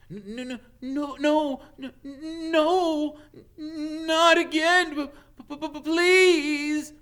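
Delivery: quavering stutter of a frightened teacher; whimpering. A narrator's reading. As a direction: beg in terror. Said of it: "somebody whimpering".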